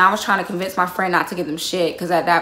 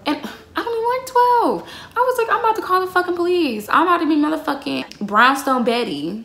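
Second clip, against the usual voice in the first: very high mocking busybody voice; mock Karen voice; 8 semitones higher than usual. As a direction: ham up a nosy neighbor threatening police. Self-mocking outraged neighbor voice.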